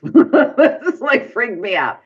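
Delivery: laughing through the words; high burst of laughter as he speaks. Real, not acted. Laughing at his own scary story.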